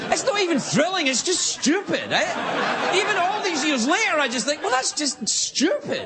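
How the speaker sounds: high-pitched comic exasperation; exasperated stand-up outburst, high and incredulous; exasperated rant. Acted. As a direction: rant in baffled disbelief, voice way up. Ranting about stupid extreme stunts.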